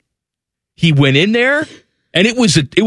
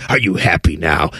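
mock accusing critic; 11 semitones lower than usual. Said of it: "like, are me".

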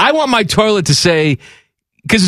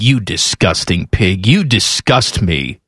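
voicing a disgusted toilet; 8 semitones lower than usual. Host voicing his toilet, low and sneering. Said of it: "my toilet's like".

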